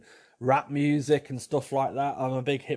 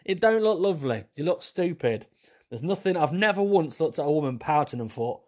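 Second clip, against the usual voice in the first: normal talking voice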